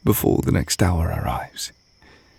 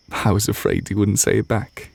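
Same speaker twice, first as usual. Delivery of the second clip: normal talking voice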